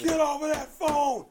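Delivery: high, very loud shouted order; full-volume, high-pitched bossy holler; imitating her yelling. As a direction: yell it like you're scolding across the room. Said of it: "it's him she's yelling at".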